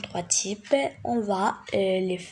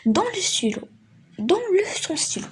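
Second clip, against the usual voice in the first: commanding order; 9 semitones higher, faster than usual. Shown as a demonstration. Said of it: "Parce qu'il nous donne un ordre".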